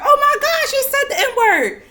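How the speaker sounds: high, loud, scandalized mock gasp; hammed-up horrified voice; mocking shocked listener. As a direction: parody a pearl-clutching viewer. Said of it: "y'all about to be triggered".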